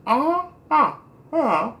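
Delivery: high garbled uh-huh with mouth pried open; patient mumbling while the dentist works, mouth wide open; mouth held open. Retold or imitated. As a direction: answer through an open mouth. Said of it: "you be like".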